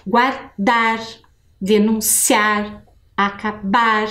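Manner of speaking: "guardar, denunciar, acabar" with the last syllable of each word leaned on; slow "denunciar", stress landing on "ciar". Shown as a demonstration. Teacher showing the class the strong syllable in each word. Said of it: "Olha só".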